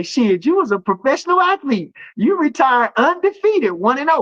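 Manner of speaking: high-pitched playful impression of a cousin; cousin's voice. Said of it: "this dude is so funny, he gonna say".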